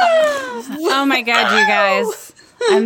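slow, rising excited squeal. Real, not acted. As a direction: gush with delight. Guest's excited greeting.

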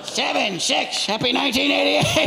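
a befuddled stroke-slurred host finishing the count; Dick Clark after stroke. Acted. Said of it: "Dick Clark with the stroke".

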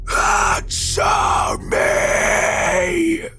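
very long, high rasping shriek; monstrous raging scream. A narrator's reading. Gray man smashing the windshield, screams in rage. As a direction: hold the last word in a huge harsh scream.

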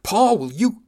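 loud, high, furious bark; officer barking at a junior crewman, loud and angry. Acted. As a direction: snap the name out at full volume. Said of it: "turned red and barked".

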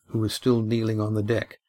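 usual speaking voice

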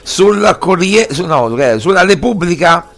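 indignant. Real, not acted. Outraged protest about press coverage.

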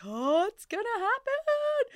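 high, loud, excited squeal; thrilled that labor is finally starting. Retold or imitated. Mother realizing birth is coming, high excited squeal. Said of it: "And suddenly I was like".

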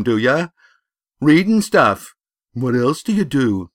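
loud, high-pitched sneering bully; bully boy, jeering. A narrator's reading. Bully taunting his rival about his one-eyed teacher, loud jeer. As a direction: pelt your rival with jeering questions.

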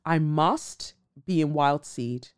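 ordinary talk, nothing marked

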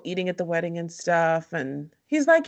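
ordinary talk

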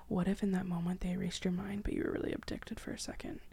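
hushed, breathy spooky whisper; conspiratorial whisper about alien abduction. Real, not acted. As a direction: whisper it like a secret.

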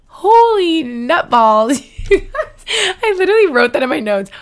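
high, loud squeal of delight breaking into laughter; giddy laughing. Real, not acted. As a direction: burst out laughing through the words. Overjoyed at her first offer.

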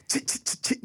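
whispered skunk chit sounds; mimicking a defensive skunk; skunk chitter. Shown as a demonstration. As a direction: imitate the skunk's warning chitter. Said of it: "they pounce and go".

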